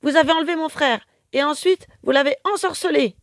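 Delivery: high, loud, fast accusing rant; furious accusation. A narrator's reading. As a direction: accuse them bitterly. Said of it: "crie Carmelita".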